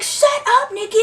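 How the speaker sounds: very high-pitched imitated retort; mock squeal. Teased friend to Nikki, mock squeal. Retold friend shouting back.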